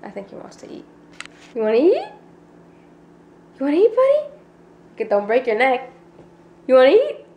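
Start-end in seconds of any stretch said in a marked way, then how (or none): 1.5-7.2 high coaxing pet voice. Real, not acted. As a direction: coo to the dog excitedly. Talking to the dog.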